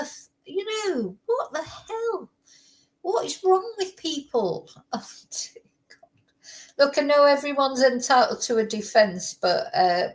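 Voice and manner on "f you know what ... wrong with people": high, soft, crying-edged disbelief; exasperated wail. Real, not acted. Ranting about the defense lawyer.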